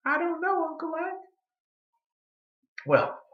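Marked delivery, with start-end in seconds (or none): 0.1-1.3 put-on higher, lightly puzzled old woman; granny voice. Granny Beecher answering Uncle Ike, high puzzled voice. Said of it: "What do you think".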